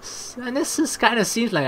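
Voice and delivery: ordinary talk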